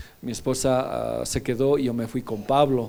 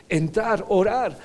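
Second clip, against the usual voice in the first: ordinary talk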